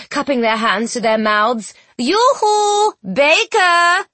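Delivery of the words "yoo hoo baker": high, long-held hailing call. A narrator's reading. Children hailing the absent baker, calling out. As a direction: call across a distance in a raised pitch. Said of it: "the children called".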